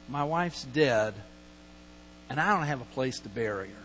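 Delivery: low, soft acted admission of loss; mildly mournful, hushed retelling; subdued bereaved husband. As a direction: quietly voice a widower's plea, understated. Voices bereaved Abraham asking for land.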